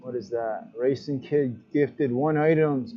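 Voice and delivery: ordinary delivery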